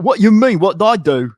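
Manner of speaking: loud, high mock protest from dad; imitating dad. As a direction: play the dad as innocent and defensive. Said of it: "And he's like".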